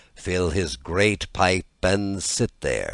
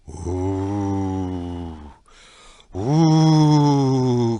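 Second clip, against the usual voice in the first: long rising ooh of the wind, louder on the second; howling wind; 3 dB louder than usual. A narrator's reading. North wind howls at Shingebis, rising wail. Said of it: "cried the north wind".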